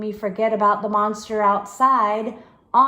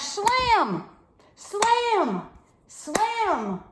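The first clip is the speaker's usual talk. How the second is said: dramatic shout; 11 semitones higher, much slower than usual. A narrator's reading. Monster crashing into door.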